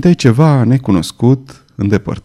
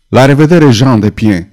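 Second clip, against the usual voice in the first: ordinary delivery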